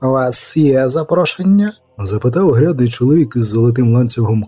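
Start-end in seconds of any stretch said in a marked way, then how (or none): 0.0-1.7 haughty chamberlain. A narrator's reading. Haughty chamberlain addresses them.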